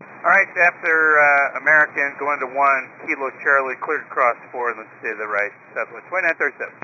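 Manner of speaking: crackly radio transmission; radio static bursts. Real, not acted. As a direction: pilot heard over the radio. Pilot radio readback.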